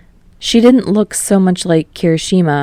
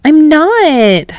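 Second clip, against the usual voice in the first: a high, louder indignant cry; exclaiming; 10 semitones higher, 5 dB louder than usual. Acted. Toshiko protesting to her brother, high and loud. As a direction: protest it like a kid who's been teased. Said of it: "she exclaimed".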